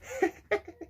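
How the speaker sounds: high mocking snigger. Imitating classmates sniggering at him, a high mock laugh. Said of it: "people sniggering like this".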